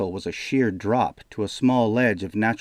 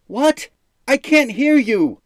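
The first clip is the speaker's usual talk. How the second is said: high, loud shout; 8 dB louder than usual. A narrator's reading. Jaden yells to the mumbling demon, loud. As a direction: yell across the distance.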